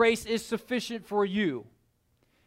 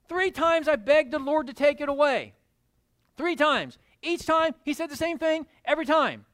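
preaching; 3 semitones higher than usual. Acted. Preacher stirring up the congregation about thorns. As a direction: build the sermon, higher and stronger.

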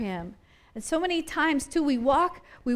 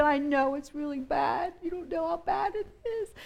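higher, softer, put-on moaning voice; whiny complainer; 5 semitones higher, 4 dB quieter than usual. Preacher mimicking someone wallowing, in a higher, soft voice. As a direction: moan about how bad it is. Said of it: "And then people go".

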